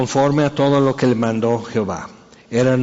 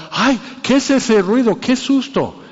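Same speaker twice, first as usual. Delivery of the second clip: much higher, hammed-up frightened squeal; mock fright; 9 semitones higher than usual. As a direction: play a silly scare at a noise. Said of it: "no es nada más".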